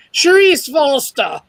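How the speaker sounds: slow, put-on posh British; posh British accent. Guest reading writers' credits, very posh British accent. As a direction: draw the name out in mock-posh British. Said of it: "you need to be very posh for that one".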